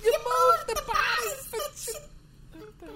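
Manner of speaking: high, hammed-up accusation; film dad's outburst. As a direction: yell it in squeaky mock anguish.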